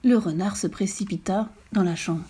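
ordinary delivery

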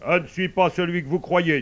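firm, projected character voice; assertive sun. Acted. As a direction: play an insistent, loud sun.

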